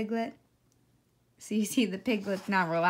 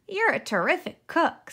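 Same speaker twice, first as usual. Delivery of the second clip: cheerful piglet; 7 semitones higher, 6 dB louder than usual. A narrator's reading. Piglet praises fox.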